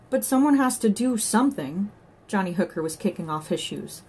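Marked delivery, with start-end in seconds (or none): 0.1-1.9 higher, louder, pressing insistence; raised voice, urgent; urgent protest. A narrator's reading. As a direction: insist with rising alarm. Johnny urgent to save drowning child.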